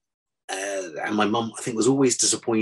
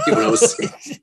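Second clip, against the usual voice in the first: laughing; 7 dB louder than usual. Real, not acted. Laughs at his own slip.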